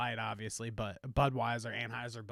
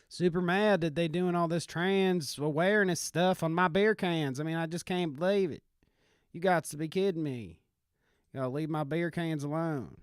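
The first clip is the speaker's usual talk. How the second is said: put-on Southern country bumpkin accent. Bumpkin protesting about his beer cans, Southern country accent. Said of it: "country bumpkins".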